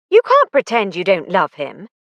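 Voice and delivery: usual speaking voice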